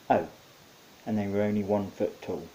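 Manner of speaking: ordinary delivery, nothing marked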